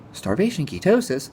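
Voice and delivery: fake-startled, like he's found a scandal; mock gasp. Vlogger mocking his critics, a hammed-up gasp.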